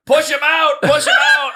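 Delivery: high, loud laughing shout; panicked yelling. Retold or imitated. Retelling a fight in the back seat, laughing panicked shout. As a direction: yell it at your friend in the back seat. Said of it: "I'm like".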